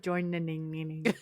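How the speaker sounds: "join-ning-ning-ning" with the last syllable repeated; stuttered ending. Retold or imitated. Replaying her own slip, "join-ning-ning-ning" with a stuck ending. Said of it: "I feel like I said".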